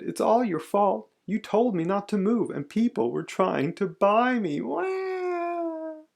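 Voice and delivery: high, whiny complaint into a long wail; blame, then bawl theatrically; mock childish crying, high-pitched. A narrator's reading. Child blames father and wails.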